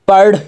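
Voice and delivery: পাড় with a distinct flapped r at the end; clear final r. Shown as a demonstration. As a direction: finish পাড় on a crisp r. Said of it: "এরকম হবে". (পাড়-এর শেষে স্পষ্ট র)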